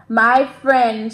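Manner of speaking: loud, mild storybook shout. A narrator's reading. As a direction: call it out as the story dragon. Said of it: "shouted Dram".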